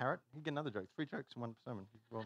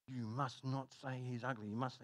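softer, measured mock admonishment; hushed warning; 7 dB quieter than usual. Parrot's owner warning it not to say ugly, hushed.

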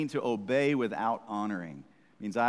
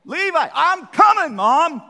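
very high, loud annoyed yell; exasperated shout back; 14 dB louder than usual. Retold or imitated. Son yells back at his mom, exasperated. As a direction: holler back at mom, fed up.

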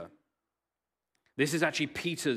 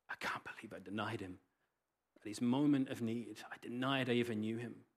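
soft, broken murmur; quiet regret; 2 semitones lower, 8 dB quieter than usual. Acted. Peter after the cock crows, soft remorseful murmur. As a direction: say it softly, full of regret.

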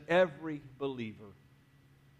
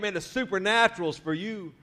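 lifted, eager declaration; rally the room with excitement; really excited; 3 semitones higher than usual. Shown as a demonstration. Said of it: "And then really get excited".

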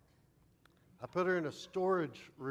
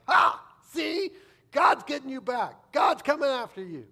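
much louder and higher, triumphant mock taunt; gloating sister; 13 semitones higher, 17 dB louder than usual. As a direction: crow it at the brother like a sister who finally won. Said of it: "And my sister's like".

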